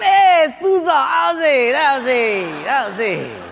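Portuguese guy calling out to his Portuguese friend, pidgin cheer; pidgin greeting to a buddy. Acted. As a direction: greet a pal in Hawaiian pidgin.

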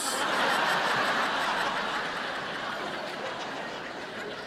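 breathy silent laugh at his own joke; real laughter. Real, not acted.

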